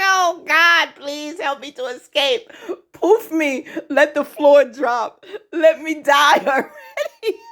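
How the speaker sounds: high, desperate begging through laughter; laughing mock plea. Mimicking nervous Gary begging to escape, laughing mock despair. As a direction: plead to vanish, high and cracking up.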